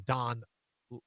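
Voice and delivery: ordinary talk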